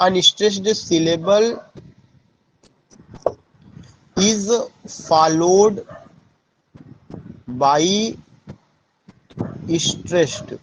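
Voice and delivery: each word set apart by long pauses, as if for notes; spaced-out teacher dictation; slow dictation. Shown as a demonstration. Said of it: "बगैर दबाव".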